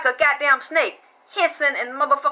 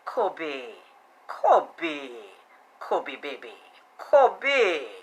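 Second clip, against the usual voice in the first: put-on soft, low, cooing Kobe, baby; 8 semitones lower, 4 dB quieter, much slower than usual. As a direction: coo the name tenderly. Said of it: "why he calling that man name like that".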